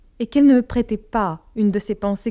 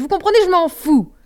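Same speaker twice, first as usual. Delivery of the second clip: loud, higher, defiant shout; 9 semitones higher, 8 dB louder than usual. Acted. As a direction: yell it in teenage revolt. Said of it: "criai-je".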